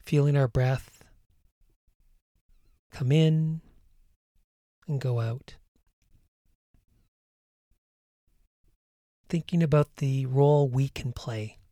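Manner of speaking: calm meditation voice over music, unhurried; guided meditation. Acted. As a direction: ease the listener into breathing and calm.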